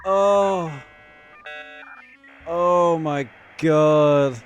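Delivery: nostalgic delight. Real, not acted. Hears nostalgic dial-up sound.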